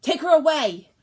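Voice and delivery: high, loud command; sharp order. Acted. Buttercup to guards, loud frightened order. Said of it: "Buttercup ordered".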